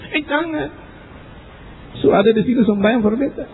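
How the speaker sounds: high mock-anxious begging; frightened pleading. Dying man begs the preacher to stay, frightened pleading.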